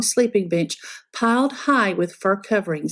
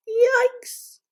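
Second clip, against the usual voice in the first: high startled yelp; startled yikes. Acted. The girl waking face to face with bears, startled yelp. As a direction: jump with fright.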